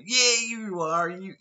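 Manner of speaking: high, slow, insistent mock American; mock American tourist. Imitating tourists insisting he is famous, drawn-out mock American accent. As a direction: stretch the words in a high American voice. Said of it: "And they go".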